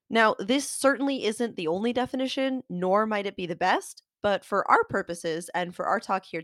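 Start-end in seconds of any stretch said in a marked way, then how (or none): none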